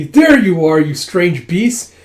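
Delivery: high, loud scolding, half crying; tearful scolding yell. Acted. Yun scolds the friends he finally found. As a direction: scold them to hide relief. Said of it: "it's like trying not to cry".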